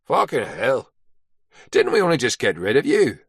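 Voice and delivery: a hint of Northern Irish accent, teasing; mild Ulster lilt, ribbing an old mate; lightly voiced Ulster brogue. A narrator's reading. Quartermaster ribbing Carter on arrival, light Ulster accent. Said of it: "his strong Ulster brogue".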